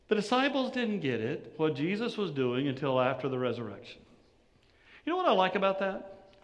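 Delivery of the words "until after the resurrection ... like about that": normal talking voice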